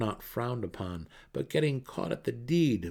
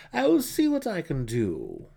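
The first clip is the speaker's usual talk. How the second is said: much higher, louder, slippery rogue; a mercenary wriggling out of a request; Jarlaxle, evasive mercenary; 8 semitones higher, 7 dB louder than usual. Acted. Jarlaxle making excuses to the matron, higher and louder.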